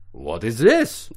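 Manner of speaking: baffled Dutch alien, rising sharply at the end; mock Dutch accent. Alien man undresses human astronaut, startled mock Dutch accent. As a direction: ask in startled, hammed-up Dutch. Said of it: "they sound like they're Dutch".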